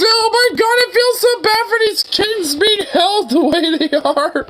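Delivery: high squealing half-laugh, outraged and delighted. Real, not acted. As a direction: laugh and shriek in disbelief at the photos. Shocked laughter at held kittens.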